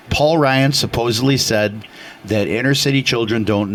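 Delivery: usual speaking voice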